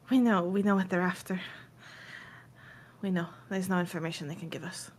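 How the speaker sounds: softly voiced, halting answer; a hint of hushed resignation; subdued Mayfair insisting. Game master voices Mayfair answering.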